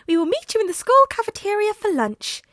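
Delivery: put-on courteous small principal addressing the boys; Nezu voice. A narrator's reading. Nezu's voice.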